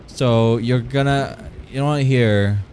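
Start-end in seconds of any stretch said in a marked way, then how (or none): none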